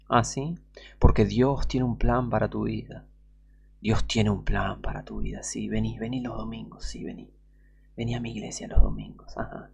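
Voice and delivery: soft, fading, coaxing invitation down to a whisper; seductive false prophet. Retold or imitated. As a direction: lure them in, sweet and hushed. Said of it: "tienen el mismo tono que su padre el diablo".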